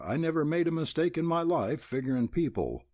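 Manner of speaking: firm, quick, sure of himself; stoutly. A narrator's reading. Laddie insisting to Missus Belding, stout and quick. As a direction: brush off her doubt, fast and firm. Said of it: "said Laddie stoutly".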